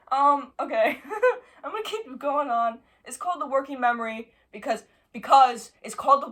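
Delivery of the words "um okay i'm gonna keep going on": laughing it off nervously; nervous laughter. Real, not acted. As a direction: talk through shaky laughter. Laughing after the bug.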